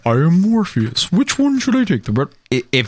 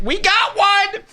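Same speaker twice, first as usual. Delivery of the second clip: much higher, shrill squeal; Janine impression. Reactor imitating Janine from Ghostbusters, high squeal. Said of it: "It was Janine, remember, from Ghost?".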